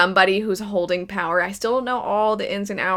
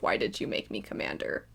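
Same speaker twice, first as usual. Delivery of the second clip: hushed sad quote; 11 semitones lower, 11 dB quieter than usual. Retold or imitated. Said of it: "the whole part with Hanji of".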